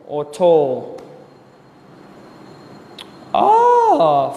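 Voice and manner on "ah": sudden high shout of surprise; spotting a student's name, loud surprised cry. Real, not acted. Sudden outburst reading names.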